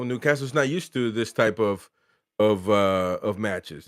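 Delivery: usual speaking voice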